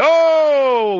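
high mock cheering whoop; mock crowd whoop.

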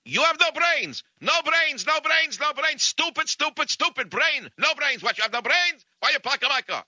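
put-on high-pitched yelling in a thick Russian accent; screaming Russian. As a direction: rant like a crazed Russian whose bumper got hit. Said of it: "screaming and yelling".

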